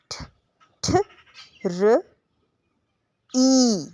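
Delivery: ठ, ठ, र, ई split apart and said slowly; sounding out tree. Shown as a demonstration. Teacher sounding out tree for children. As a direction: pull ट्री apart sound by sound. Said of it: "तो अब हमने ट्री को किस तरह पढ़ना है".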